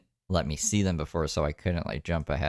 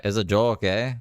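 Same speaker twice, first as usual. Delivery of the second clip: mock Canadian accent; 3 semitones higher, 6 dB louder than usual. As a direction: mimic him with a touch of Canadian. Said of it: "why did he sound Canadian there".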